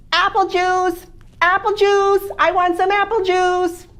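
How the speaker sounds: put-on high, loud whiny toddler chant; baby voice. Little baby to his family at breakfast, whiny high demand.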